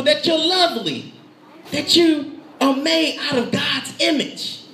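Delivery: high, driving pulpit climax; full-force preaching. Real, not acted. Preacher warns the enemy steals your destiny, full-force preaching.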